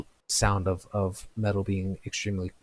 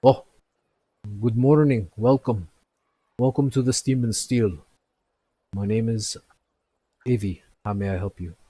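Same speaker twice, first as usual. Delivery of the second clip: bright shopkeeper greeting; 2 semitones higher, 6 dB louder than usual. Acted. Blacksmith greeting new customers, cheerful and loud. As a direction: greet the customers warmly from behind the counter. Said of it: "he looks up to you and he goes".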